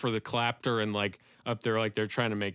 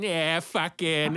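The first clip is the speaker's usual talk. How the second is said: put-on drawn-out, higher Boston accent; 5 semitones higher, much slower than usual. Comic imitating a stand-up's filtered anger, Boston accent. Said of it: "funneled through the Boston accent".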